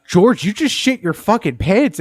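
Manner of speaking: loud, high, slow disbelieving yell; mock yell. Telling coworker he shat himself, loud high yell. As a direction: yell it at him in shock. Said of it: "And I'm like".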